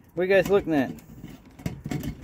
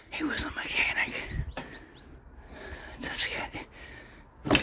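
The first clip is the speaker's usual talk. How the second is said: hushed, voiceless whisper; whispered aside; 14 dB quieter than usual. Real, not acted. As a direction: whisper it as if to yourself. Hushed aside about the former owner.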